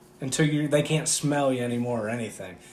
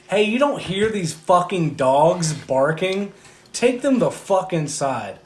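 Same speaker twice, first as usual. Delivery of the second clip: higher, louder, drawn-out angry yell at a neighbor; retold yell; 5 semitones higher, 5 dB louder, slower than usual. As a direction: yell across the yard, stretching each word. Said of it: "I yelled".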